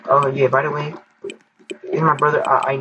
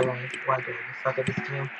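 usual speaking voice

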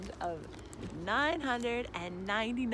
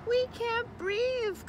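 high, drawn-out squeezed frog complaint; young frog calling; 13 semitones higher, slower than usual. A narrator's reading. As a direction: call out high and slow as a crowded little frog. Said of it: "called another".

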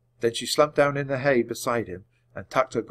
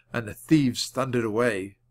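plain reading voice

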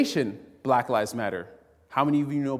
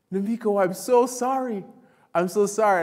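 apologetic driver; 7 semitones higher, 4 dB louder than usual. Retold or imitated. Driver apologizes after slur.

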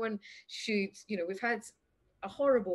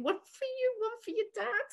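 high sweet hostess voice. Retold or imitated. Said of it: "she's like".

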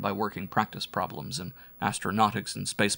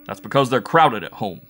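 loud, blunt retort; Bull Mike, rebutting; 7 dB louder than usual. A narrator's reading. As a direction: throw the rebuttal back forcefully. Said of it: "was Bull Mike's rebuttal".

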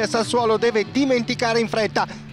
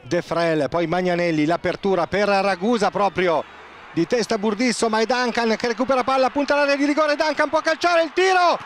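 Italian football commentary; live play-by-play. Acted. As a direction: call the action live, building to the post. Live play-by-play, post hit.

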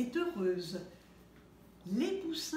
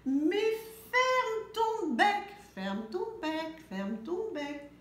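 high, slow, loud sung-out start; singsong shout; 7 semitones higher, 3 dB louder, slower than usual. A narrator's reading. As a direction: belt the first line then settle. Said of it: "se mettent à crier".

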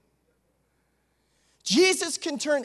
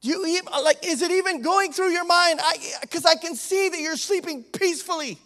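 exasperated plea; 7 semitones higher, 5 dB louder than usual. Acted. Preacher voices disciples' panic.

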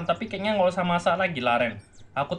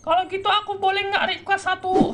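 much higher, put-on motherly voice; a mother overjoyed to find her son; mother character voice. Mother reunited with her son Martin, much higher put-on voice.

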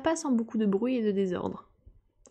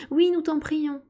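higher-pitched group entreaty; exclaimed plea; 7 semitones higher, slower than usual. A narrator's reading. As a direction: join in eagerly begging. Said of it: "tous les autres s'exclamèrent en chœur". (prière exclamée, voix aiguë)

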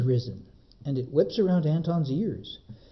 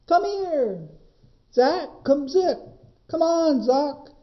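much higher, loud carrying calls; calling the goats; 11 semitones higher, 4 dB louder, slower than usual. Acted. Anton calling his lost goats through the forest, high and loud. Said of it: "He calls in vain".

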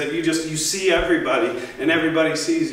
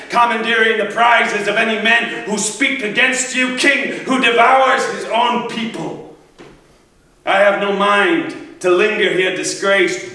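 loud, high, declaimed accusation; angry hero denouncing his king; 5 semitones higher, 4 dB louder than usual. Acted. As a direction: hurl a raised, scornful tirade at a cowardly king. Achilles rails at the king.

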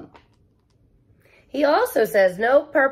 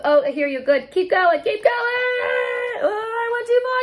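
cheering on; 13 semitones higher, faster than usual. Acted. Reader urges kids to keep drumming, excited. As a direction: egg the drum roll on.

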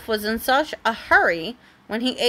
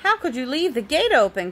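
angry call; 4 semitones higher than usual. A narrator's reading. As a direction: scold from across the meadow. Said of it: "an angry voice made him jump".

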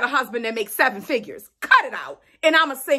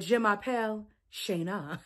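mock French; 5 semitones lower, 10 dB quieter than usual. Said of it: "Y'all know I used to really speak French".

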